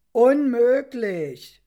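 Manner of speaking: loud, slow cry of disbelief. A narrator's reading. Narrator cries out to Petrus in disbelief, loud and slow. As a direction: stretch it out loud in shock. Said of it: "rief ich".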